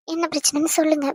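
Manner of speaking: ordinary delivery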